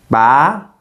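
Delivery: "Ba" rising like a question; questioning, climbing "Ba"; second tone. Shown as a demonstration. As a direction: slide "Ba" upward in pitch. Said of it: "The second tone rises".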